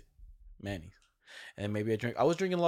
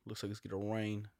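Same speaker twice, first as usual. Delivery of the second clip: ordinary talk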